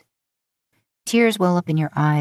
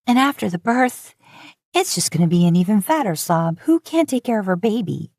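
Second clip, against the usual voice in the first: higher, tearful self-pity; weeping; 5 semitones higher than usual. Acted. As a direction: sob the words out, a little raised. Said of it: "You sniffle and weep".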